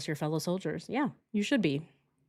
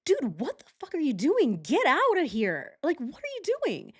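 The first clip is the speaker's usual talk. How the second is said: high, confronting yell at the intruder; retold angry yell; 8 semitones higher than usual. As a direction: demand he get out, pitched high. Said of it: "And I'm like".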